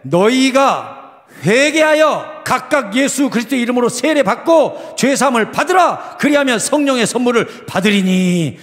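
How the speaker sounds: loud, high scripture declamation with drawn-out words; Peter's proclamation. Acted. Preacher reading Peter's words to the congregation, proclaiming loudly. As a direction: proclaim it slowly to the crowd. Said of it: "베드로가 이르되".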